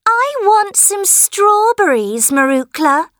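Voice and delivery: put-on high whiny demanding girl; spoiled girl voice. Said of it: "Helen said".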